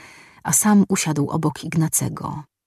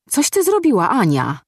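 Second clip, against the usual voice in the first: sudden high, loud cry of alarm; shouted; 7 semitones higher, 4 dB louder than usual. A narrator's reading. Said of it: "krzyknął Ignacy".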